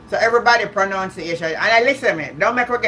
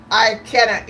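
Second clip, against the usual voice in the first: usual speaking voice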